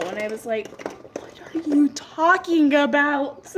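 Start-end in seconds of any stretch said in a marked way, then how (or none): 1.2-3.5 slow, forceful breathy whisper; whispered disbelief. Retold or imitated. Clerk baffled by customer's sad confession, slow incredulous whisper. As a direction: whisper it slowly in acted-out bafflement.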